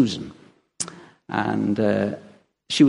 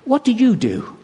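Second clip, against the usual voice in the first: incredulous exclamation; 10 semitones higher, 4 dB louder than usual. Retold or imitated. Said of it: "retold quip".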